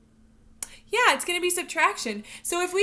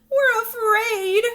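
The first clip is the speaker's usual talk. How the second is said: slow, much higher, louder wail of fear; afraid fish; 9 semitones higher, 9 dB louder, much slower than usual. Acted. Teacher voicing the frightened fish in the story, hammed-up scared cry. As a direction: whimper it like a scared little fish. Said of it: "cried the fish".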